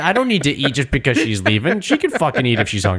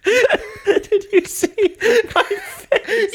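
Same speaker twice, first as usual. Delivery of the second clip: high, slow, through laughter; can barely speak for laughing; much slower than usual. Real, not acted. Both cracking up.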